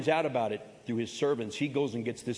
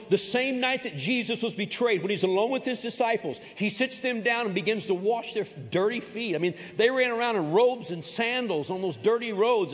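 fervent preaching; 7 semitones higher than usual. Acted. Pastor telling the church of Jesus washing feet, fervent. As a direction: build the story with rising fervor. Preaching builds higher and louder.

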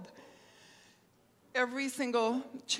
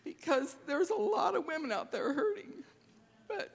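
real tears, voice pitched up as she cries; crying; 7 semitones higher than usual. Real, not acted. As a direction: let the tears break through on the words. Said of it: "I get emotional".

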